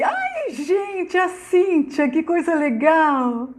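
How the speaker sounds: high, delighted squeal, stretching the last words; excited greeting. Real, not acted. Delighted as a viewer joins the live. (cumprimento empolgado, voz bem aguda)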